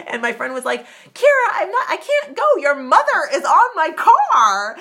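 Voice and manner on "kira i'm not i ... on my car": loud, high-pitched acted protest; panicked shouting. Friend refusing to drive off with mother on car, panicked shout. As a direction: re-enact a friend shrieking in disbelief.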